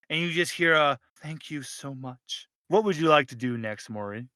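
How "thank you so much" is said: soft grateful thanks. Acted. Giant entity whispers thanks.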